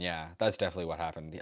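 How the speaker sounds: normal talking voice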